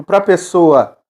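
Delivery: usual speaking voice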